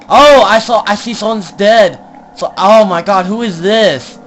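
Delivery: high, loud shocked rush; gasping with shock; alarmed. Real, not acted. Finds dead body in game.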